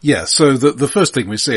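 normal talking voice

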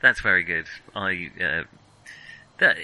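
ordinary talk

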